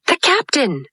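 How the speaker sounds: high startled pirate; surprised. A narrator's reading. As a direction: blurt it in surprise.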